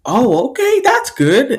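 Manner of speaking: high, upbeat reaction; impressed approval. Acted. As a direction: approve with lifted pitch. Classmates approve.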